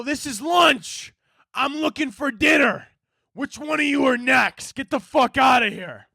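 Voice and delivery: high, hammed-up yelling threat; threatening shout. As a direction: yell a mock threat at the birds. Acted shouting threat at the seagulls.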